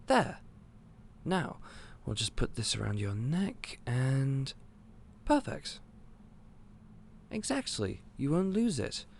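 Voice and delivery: proud of a neat little fix; pleased delight. Acted. Shopkeeper hanging the key on the customer, delighted.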